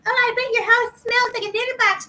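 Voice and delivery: high, whiny put-on voice; mocking visitor. Cat owner imitating a rude visitor, mocking. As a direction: mimic a judgmental guest in a silly high voice. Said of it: "when somebody comes in and goes".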